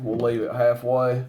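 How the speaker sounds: normal talking voice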